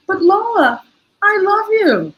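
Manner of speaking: put-on high little-girl voice, earnest; child's voice. Grandmother retelling her granddaughter's goodbye, high child voice. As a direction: play the small granddaughter saying it.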